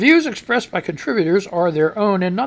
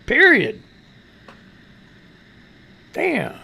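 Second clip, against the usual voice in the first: ordinary delivery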